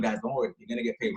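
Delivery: soft, low reassurance; reassuring. Retold or imitated. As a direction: settle worried guys gently. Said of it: "you're assuring them".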